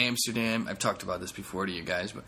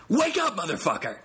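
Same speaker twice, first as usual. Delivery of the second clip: mock wake-up yell; 10 semitones higher, 5 dB louder than usual. Podcaster yelling at dozing listeners, mock wake-up shout. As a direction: jolt the sleepers awake. Jokingly rousing sleeping listeners.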